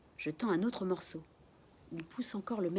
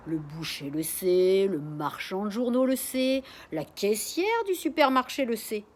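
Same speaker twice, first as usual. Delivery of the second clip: put-on louder, indignant old man ranting; gossipy old neighbor; 3 semitones higher, 7 dB louder than usual. A narrator's reading. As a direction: grumble and scold like an old busybody. Neighbor's long rant through wall.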